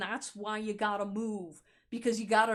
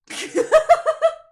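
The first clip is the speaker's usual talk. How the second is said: a loud high-pitched laugh bursting out; high burst of laughter; 15 dB louder than usual. Real, not acted. Laughing loudly at her own joke to viewers, high burst of laughter.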